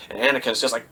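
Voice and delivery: ordinary delivery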